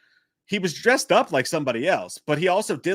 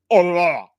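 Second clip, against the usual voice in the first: loud mock "too into it" noise; overeager slobbering imitation; 3 semitones higher, 8 dB louder than usual. Imitating the man obeying her order, overeager noise. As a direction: overdo the eager mouth noise, loudly. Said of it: "he was too into it".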